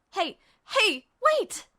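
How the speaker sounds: high, cracking cry of wait; desperate call. Acted. Ed calls the leaving doctor back, panicked. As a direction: call after him in panic.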